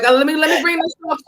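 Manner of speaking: high, quick showing-off voice; mock announcing. Showing off her friend's show, high and eager. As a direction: present it like a proud host. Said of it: "I'm like".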